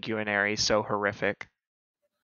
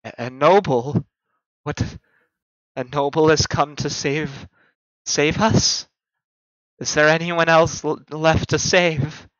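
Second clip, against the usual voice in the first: lightly voiced frail old lady; a hint of a trembling elderly woman; feeble old woman; 3 semitones higher than usual. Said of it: "a feeble old woman's voice".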